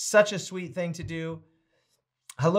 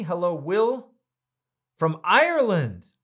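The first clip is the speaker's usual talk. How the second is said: ordinary delivery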